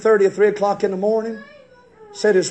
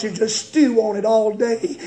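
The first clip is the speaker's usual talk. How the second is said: ordinary delivery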